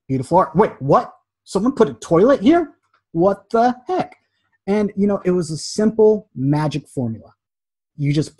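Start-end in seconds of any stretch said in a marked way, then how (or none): 0.1-4.2 higher, rising double-take; mock shock. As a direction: act a baffled gallery visitor. Acted gallery visitor's shock.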